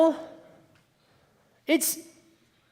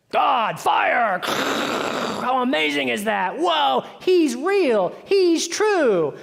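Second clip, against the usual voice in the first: drawn-out, amazed exclaiming, climbing higher; acted awe; 3 semitones higher, much slower than usual. Onlooker marvels at God's fire, hammed-up awe. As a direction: marvel aloud, stretching the words.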